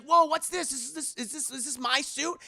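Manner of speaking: high whoa into stammered questions; awed teen. Retold or imitated. Imitating Peter finding the suit, high amazed stammer. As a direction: imitate a kid amazed by a present.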